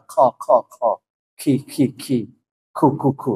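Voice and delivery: "kha, ki, ku" with airy k releases; qaf turned into kaf. Shown as a demonstration. Teacher showing sounds that belong to kaf, aspirated. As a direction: rattle off each syllable with a puff of breath. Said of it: "tidak boleh mengeluarkan udara".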